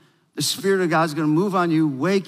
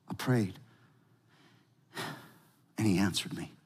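hushed, much softer and lower reading; soft scripture reading; 11 semitones lower, 11 dB quieter than usual. Acted. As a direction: read it gently, almost to yourself. Reads scripture softly.

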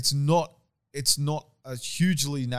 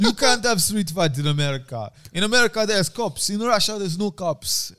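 put-on playful Russian accent, pitched up; Khabib impression; 7 semitones higher, 5 dB louder than usual. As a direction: lecture it in a hammed-up Russian accent. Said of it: "fucking Khabib's like".

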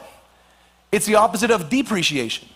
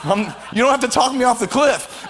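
ordinary talk

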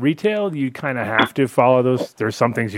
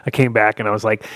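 usual speaking voice

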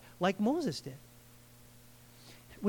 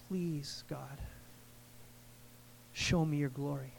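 soft, low plea to God; low and hushed, a humble request; hushed prayer; 6 semitones lower, 10 dB quieter than usual. Retold or imitated. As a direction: pray it quietly, with pauses. Quietly voices Moses' prayer to God.

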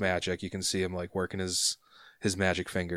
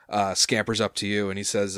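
normal talking voice

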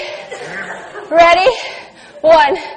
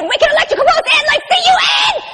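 ecstatic burst; 7 semitones higher, faster than usual. Acted. Performer reaching the peak of her reverie, fast ecstatic shout. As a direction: let it explode out of you.

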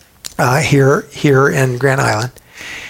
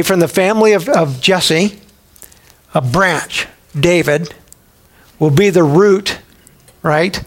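ordinary talk

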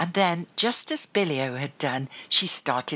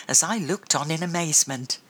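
ordinary delivery

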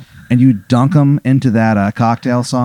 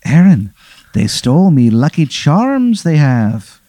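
slow, grave mock-Irish accent; Easy Irish; slower than usual. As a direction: lament solemnly in a hammed-up Irish brogue. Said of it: "looks at you very seriously".

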